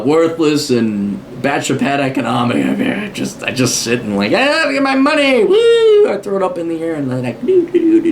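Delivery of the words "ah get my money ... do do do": much higher, cheering as money rains down; gleeful whoop. Pretend. An author imagines sudden riches, throwing cash and whooping. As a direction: whoop it up like a new millionaire. Fantasizing about raining money.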